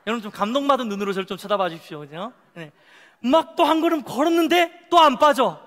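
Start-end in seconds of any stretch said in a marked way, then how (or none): 3.2-5.6 high, loud acted astonishment; amazed. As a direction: play a man thrilled he isn't sinking. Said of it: "막 놀라면서". (놀란 듯 높고 큰 목소리로)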